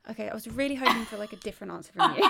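fast, laughing through the words; quick giggly complaint; laughing rush. Real, not acted. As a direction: rattle it off while laughing. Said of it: "laughing rush".

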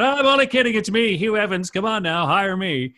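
high, loud gleeful reveal; big reveal. Retold or imitated. Radio guy unmasking his fake Swedish persona, high and loud. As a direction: burst out grinning with the big reveal. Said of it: "all of a sudden I come out".

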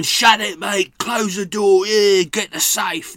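London robber. Acted. Geezers robbing a bank shout orders, rough London robber voice. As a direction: boss the bank staff like a London villain. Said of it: "record myself as loads of different kind of, uh, London-based robbers".

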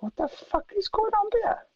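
high-pitched baffled squeak; retold shock, voice shooting up; stunned disbelief. Retold shock at star sitting between.

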